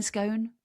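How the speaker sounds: "scone" rhyming with bone; long o in "scone". Shown as a demonstration. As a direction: say "scone" as skone. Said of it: "I don't like saying scone".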